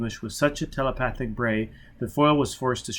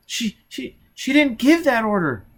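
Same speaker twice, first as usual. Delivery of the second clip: put-on high, halting child's voice; high frightened child faltering; scared stammering child; 11 semitones higher, 4 dB louder than usual. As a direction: stumble over the words in fear.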